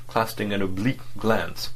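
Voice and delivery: ordinary delivery, nothing marked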